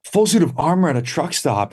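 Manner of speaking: high, incredulous echo; amazed disbelief. Real, not acted. Hearing about armor at a truck stop, high disbelief. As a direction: repeat it back like you can't believe it.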